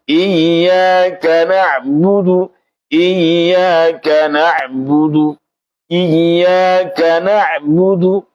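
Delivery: Iyyaka na'budu repeated very slowly with full vowels; drawn out slowly, syllable by syllable; careful demonstration. Shown as a demonstration. Teacher drilling the students, slow repeated recitation.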